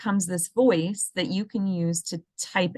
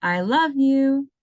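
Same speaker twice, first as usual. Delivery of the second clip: heartfelt recorded message; 7 semitones higher, 6 dB louder, slower than usual. Shown as a demonstration. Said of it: "It's gonna sound like".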